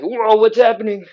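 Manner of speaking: hammed-up whoa of losing balance; mock alarm. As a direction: play a wobbling student's startled cry. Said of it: "you're like".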